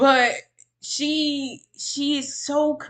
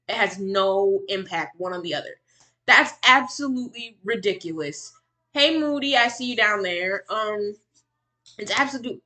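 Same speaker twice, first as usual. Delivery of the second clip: ordinary delivery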